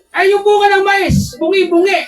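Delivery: excited exclamation. Real, not acted. Exclaiming at the ruined corn.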